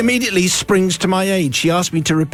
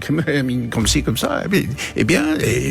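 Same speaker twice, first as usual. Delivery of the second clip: low, hesitant mock French; vague French muttering; 3 semitones lower than usual. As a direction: fumble through fake French phrases. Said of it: "muttering vague French phrases".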